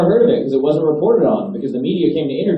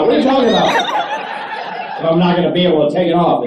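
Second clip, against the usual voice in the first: hammed-up furious passenger; mock outrage; 7 semitones higher than usual. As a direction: yell at her in disbelief. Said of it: "I lost my shit".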